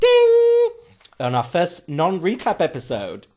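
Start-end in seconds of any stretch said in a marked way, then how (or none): 0.0-0.7 high, loud sung ding; vocal ding. Pretend. Host hits a bell sound for the announcement. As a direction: ring out like a bell.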